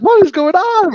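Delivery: high, loud giddy squeal of disbelief. Retold or imitated. Stoned tourists on the bus reliving the chaos, giddy high squeal. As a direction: blurt it out high and tipsy-giddy. Stoned crew baffled on tour.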